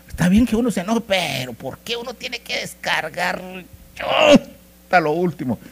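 acted exasperation, stretching the words; exasperated protest. Protesting husbands' venting on wives.

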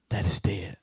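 low whisper; whispered, low, final. Real, not acted. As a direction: breathe it out unvoiced. Whispered ending.

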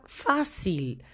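"fácil" with the first syllable stressed; teacher's demonstration of "fácil", "fá" strongest. Shown as a demonstration. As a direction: lean on "fá" in "fácil". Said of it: "la sílaba tónica es el fa".

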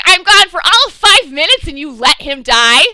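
loud mock anger with a laugh; mock scolding. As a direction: berate her like an angry friend. Diggle scolding Sarah retold.

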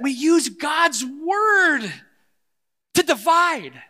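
high, loud cry with the words drawn out; impassioned shout. Real, not acted. Preacher accusing the church of misusing scripture, shouting slowly. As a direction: cry it out as an indictment, stretching each word.

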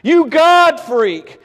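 slow, drawn-out mocking taunt of a neighbour; scoffer's taunt. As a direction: sneer it out slowly like a scornful neighbour. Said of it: "He would have been called a God freak".

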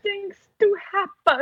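very high mock French diva voice; bad French accent. Co-host nails Carlotta's awful French accent, high and mocking. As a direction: squeal it like a campy French opera diva. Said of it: "that's exactly how she sounds".

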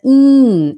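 "in" loud and stretched to "eeen"; long i. Shown as a demonstration. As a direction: hold the ee of "in" long and strong. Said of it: "é um i longo".

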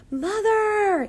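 high, loud call, drawn out; long high-pitched holler; top-of-voice yell. A narrator's reading. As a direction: yell for your mother across a field. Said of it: "yelled Flora at the top of her voice".

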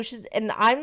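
ordinary talk

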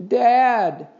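high, loud, drawn-out wail. A narrator's reading. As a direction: wail to Dad for rescue. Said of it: "wailed Henry".

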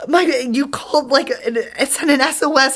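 acted-out flustered, high-pitched stammer; breathless explanation. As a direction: stumble through it high, as if winded. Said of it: "I'm breathing really hard".

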